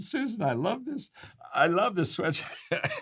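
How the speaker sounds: high-pitched delight breaking into a laugh; retold laughing delight. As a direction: gush to a friend, tickled and laughing. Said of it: "I just started laughing".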